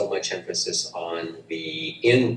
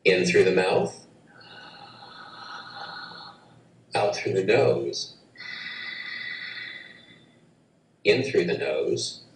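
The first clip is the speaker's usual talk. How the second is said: paced breathing instructions with long pauses; guided breath cues. Shown as a demonstration. Breathwork teacher demonstrating the cycle, calm guiding voice. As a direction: call each breath like a calm breathwork coach. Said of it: "I'll demonstrate first".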